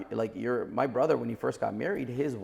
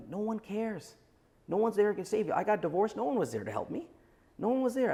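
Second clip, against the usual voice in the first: blunt tough talk; 8 semitones higher than usual. Retold or imitated. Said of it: "and I'm like".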